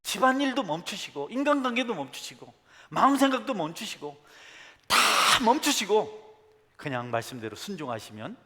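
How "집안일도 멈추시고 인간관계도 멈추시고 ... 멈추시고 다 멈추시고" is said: fervent preacher's stop-everything call; preaching climax. Real, not acted. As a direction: hammer each stop harder.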